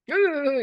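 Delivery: short high mock grunt of anger; angry groan. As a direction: imitate a child's frustrated noise. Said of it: "이렇게". (아이가 성난 소리 흉내)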